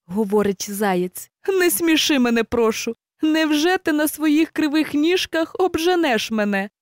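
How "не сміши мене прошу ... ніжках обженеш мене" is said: higher voice, amused disbelief; scoffing hare. A narrator's reading. Hare scoffs at the hedgehog's race offer, higher. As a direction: laugh off the challenge, a hint of a hare.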